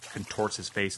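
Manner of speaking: plain reading voice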